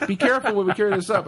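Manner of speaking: high, loud warning through laughter; mock warning. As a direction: call out a caution while cracking up. Reenacts warning while carrying beer.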